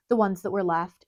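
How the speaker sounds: usual speaking voice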